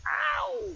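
sharp high whoop; preacher's yelp. Acted. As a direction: yelp to punch the point. Preacher's exclamation.

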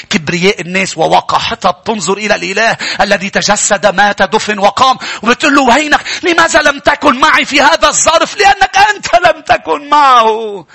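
climbs to a high, loud shout, slowing on the last line; rebuking proud people in a rising sermon shout; preaching crescendo. Acted. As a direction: build the indignation into a shout.

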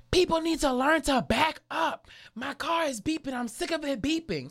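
high-pitched mock rant from the driver ahead; angry lady. Teller imitating the angry lady in the car ahead, high complaint. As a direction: whine it up high like the annoyed driver. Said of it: "the lady in front of me going".

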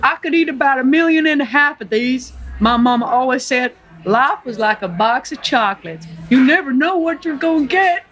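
naive Southern American character voice; Forrest Gump. Acted. Forrest chats to the nurse at the bus stop, in character. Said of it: "play the role of Forrest Gump".

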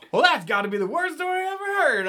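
very high, fast, loud put-on voice; amused cab driver. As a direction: squeal it out like a cartoon driver. Cab driver reacting to story.

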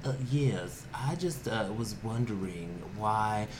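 slow, soft, unruffled caller; calm caller. Retold or imitated. Caller asking the newsroom about anchors, calmly. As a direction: imitate a very calm, measured phone caller. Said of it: "so calm about it".

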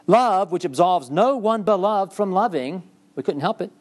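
a touch of hammed-up romance; mock charming lover. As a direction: play her as helplessly in love. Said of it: "She's very charming".

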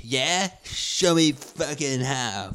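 put-on imagine the picked-on kid trying to fight back; nerd kid comeback. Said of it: "see him as a younger kid".

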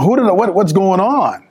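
high-pitched, stumbling retold confusion; bewildered. Son finding balloons and cake, bewildered. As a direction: puzzle aloud, pitch lifted. Acts out bewilderment at party decorations.

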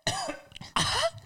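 sudden coughing fit as a drink goes down wrong; choking cough. Real, not acted. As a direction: cough hard, choking on a sip. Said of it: "it went down the wrong pipe".

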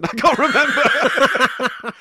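cracking up, high and slow; laughing. Real, not acted. Host laughing at his own joke, laughing.

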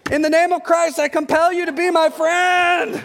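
hammed-up, pleading holy decree; mock exorcism shout. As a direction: cry it out like a desperate exorcist. Mock desperate exorcism of friendship.

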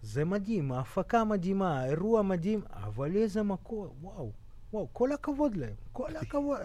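put-on light Russian accent in Hebrew; imitating a Russian friend's Hebrew accent. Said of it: "שיש לו עדיין מבטא רוסי".

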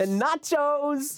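high, slow gushing wonder; mock wonder. Co-host retelling the surprise party, high slow gushing. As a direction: gush it out like a kid.

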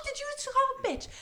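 high, loud indignant outburst; furious comeback. Retold or imitated. Friend answering the man who insulted her, furious high yell. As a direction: fire back, voice shooting up in outrage.

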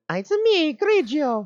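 high cartoon villain voice; squeaky Italian game villain; Mario villain voice.

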